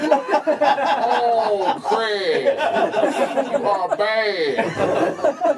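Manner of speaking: very slow, stretched mock scolding; hammed-up, drawn-out chiding; co-host's catchphrase. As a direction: drag "bad" way out. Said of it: "he just goes".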